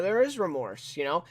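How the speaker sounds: ordinary talk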